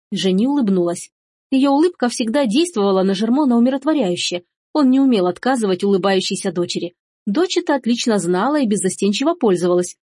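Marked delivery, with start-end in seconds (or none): none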